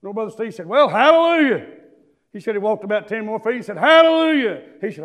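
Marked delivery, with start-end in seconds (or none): 0.7-1.7 imitating a brother's shouted hallelujah; retold praise shout. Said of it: "I want you to start shouting". 3.8-4.6 imitating a brother's shouted hallelujah; retold praise shout. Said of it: "I want you to start shouting".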